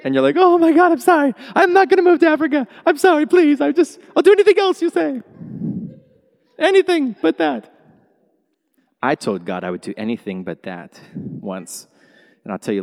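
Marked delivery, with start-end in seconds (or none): 0.4-7.7 high, loud, fast begging; mock panic, pleading not to go to Africa; panicked pleading. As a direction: beg God in a frantic squeal.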